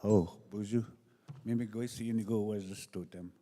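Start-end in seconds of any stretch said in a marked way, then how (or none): none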